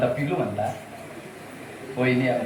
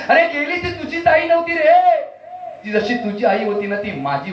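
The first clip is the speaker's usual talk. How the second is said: hammed-up wail; 9 semitones higher than usual. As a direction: overplay the sorrow to the friend. Hammed-up condolence.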